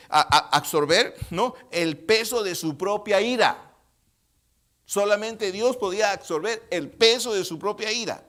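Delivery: loud, emphatic, weighted phrases; full-force preaching. Real, not acted. Preacher declaring to the congregation what only God could absorb, loud and emphatic. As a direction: press each phrase home at high volume.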